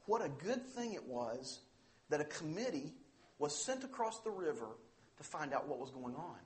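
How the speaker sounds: ordinary talk, nothing marked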